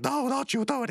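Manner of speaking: high, urgent put-on protest; mock warning. Birthday kid stopping his friend, high mock alarm. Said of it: "and he's like".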